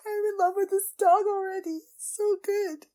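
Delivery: high voice, still teary; tearful. Real, not acted. Fan in love with the dog already, tearful high voice. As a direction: gush through the tears. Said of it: "I would cry this much".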